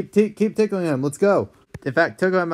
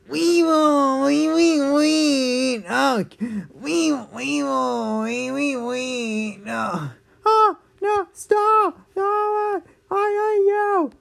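high squeaky dad stammering; stuttering squeal while tickled; being tickled; 11 semitones higher, much slower than usual. Acted. Dad squirming under tickling.

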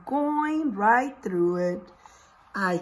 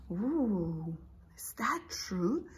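soft whispered awe; hushed wonder; 7 dB quieter, slower than usual. Acted. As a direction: whisper in amazement to the children. Teacher marvels at inchworm claim.

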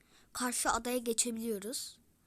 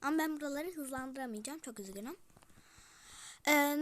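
normal talking voice

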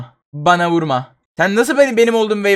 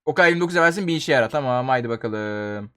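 ordinary talk

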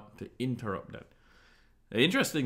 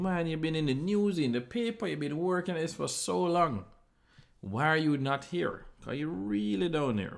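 nagging friends and family; 7 semitones higher than usual. Retold or imitated. Friends and family pestering you.

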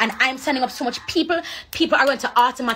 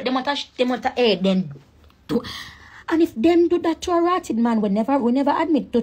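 ordinary talk

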